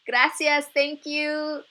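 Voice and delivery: high, loud, happy thanks; shouting back. A narrator's reading. Girls thanking the coach from the field, happy shout. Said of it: "Mia and Yesenia shouted back".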